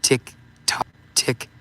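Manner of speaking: ordinary talk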